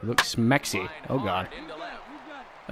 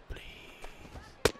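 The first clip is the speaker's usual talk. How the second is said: breathless whispered please, no voice at all; 16 dB quieter than usual. Real, not acted. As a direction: beg for the strike in a whisper. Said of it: "Just give me a strike".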